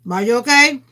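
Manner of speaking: loud high call across room. Real, not acted. Calling out to mother.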